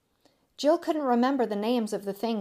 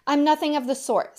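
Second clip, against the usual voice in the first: higher, louder protest; indignant denial; 6 semitones higher, 5 dB louder than usual. A narrator's reading. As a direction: protest as if stamping a foot. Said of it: "stamping her foot".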